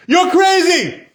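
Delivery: high, loud and drawn-out scolding yell; incredulous shout. Retold or imitated. Satan scolding Abraham for going to sacrifice, loud and slow. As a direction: shout it slowly at a madman.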